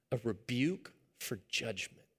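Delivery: ordinary talk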